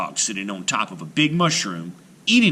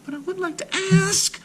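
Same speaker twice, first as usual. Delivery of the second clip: put-on little girl voice. A narrator's reading. Marusha voiced high.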